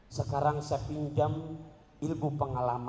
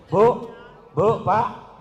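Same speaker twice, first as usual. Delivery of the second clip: calling out; 5 semitones higher, 11 dB louder, much slower than usual. Real, not acted. Calling out to congregation.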